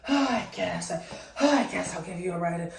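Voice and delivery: exaggerated breathy huffs of reluctance; put-upon, huffy mimicry; mock huffing and puffing. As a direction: imitate someone grudgingly agreeing with heavy sighs.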